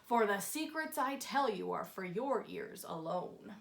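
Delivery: put-on low grunting old Onceler; a low, grumbling hermit speaking secrets; grunted Onceler. A narrator's reading. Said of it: "Then he grunts".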